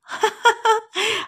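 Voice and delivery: high gleeful laugh; bubbly high laugh; burst of laughter. A narrator's reading. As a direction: let out a delighted laugh. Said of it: "আরও এক উচ্ছ্বাস হাসি".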